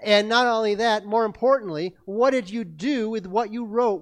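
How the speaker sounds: ordinary talk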